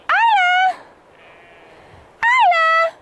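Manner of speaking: loud, very high name call. Real, not acted. Owner calls Isla from afar, loud high calling. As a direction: call high across the field. Calling animal across the field.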